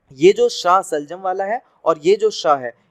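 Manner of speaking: normal talking voice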